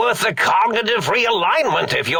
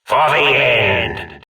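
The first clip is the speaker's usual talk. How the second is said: hammy villain broadcast; 3 semitones higher, 5 dB louder than usual. Acted. Mad scientist taunting his old colleagues over a broadcast, loud hammy gloating. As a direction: gloat like a cartoon supervillain on the airwaves.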